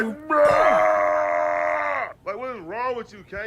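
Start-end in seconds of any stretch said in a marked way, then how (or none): 0.0-2.1 long high screaming roar; hammed-up wrestler freak-out scream; mock enraged roar. Kane freaking out back in the ring, long mock roar. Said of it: "He really tweaking. He like".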